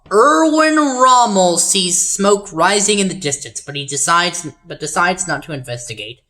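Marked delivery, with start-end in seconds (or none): 0.1-3.6 loud, higher, drawn-out name at the start; hammy announcer. Shown as a demonstration. Game host restarts the line on Rommel, hammed-up announcing. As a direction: boom out "Erwin Rommel" slowly and grandly.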